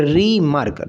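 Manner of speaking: रीमार्क slowly in two beats, री-मार्क; split into syllables. Shown as a demonstration. Teacher reads the word to count syllables, sounded out. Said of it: "यहाँ पे भी दो syllable".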